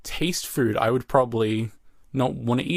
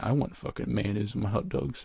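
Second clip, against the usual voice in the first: muttered much softer to himself; under his breath; 6 dB quieter than usual. Retold or imitated. In the theater beside his sister, softly muttered to himself. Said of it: "I said to myself under my voice".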